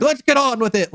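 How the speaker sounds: high-pitched mock shout without real volume. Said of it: "like, shouting".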